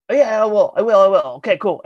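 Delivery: high, loud, breezy brush-off; hasty assurance. Retold or imitated. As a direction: imitate someone agreeing just to get away. Promoter brushing off the request.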